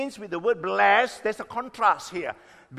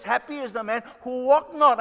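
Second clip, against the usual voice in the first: "walk not" pressed out higher and louder; stress on walk not; 5 semitones higher, 5 dB louder than usual. Real, not acted. Preacher quoting the verse to his congregation, stressing "walk not". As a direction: lean hard on "walk not". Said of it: "I want to emphasize the word walk not".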